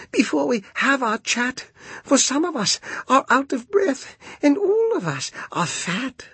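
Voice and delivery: high, tiny pleading oyster voices; little oysters begging for a pause; squeaky oysters. A narrator's reading.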